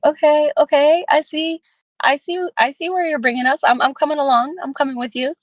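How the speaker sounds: half-playfully catching on and following along; playful eager agreement. Retold or imitated. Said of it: "I'm like".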